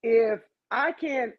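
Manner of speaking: ordinary talk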